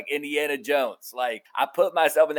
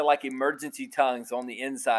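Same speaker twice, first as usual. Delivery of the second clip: ordinary delivery, nothing marked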